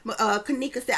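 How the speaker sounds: ordinary delivery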